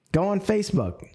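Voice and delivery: usual speaking voice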